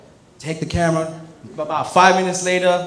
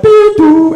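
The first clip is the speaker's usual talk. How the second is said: high loud beep doo; subway door chime sound, high and loud; door chime imitation; 11 dB louder than usual.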